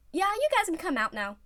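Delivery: a touch of a yawn; lazy, unfazed call. Acted. Oikawa calling the team out, lazy and unfazed. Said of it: "Oikawa yawned".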